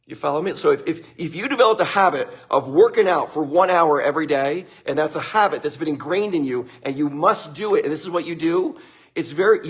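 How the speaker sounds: ordinary talk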